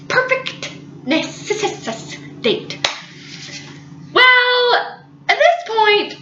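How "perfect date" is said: soft unvoiced "perfect date"; hushed, swooning "perfect date"; whispered "perfect date". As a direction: breathe it out dreamily. Breathy dreamy repeat.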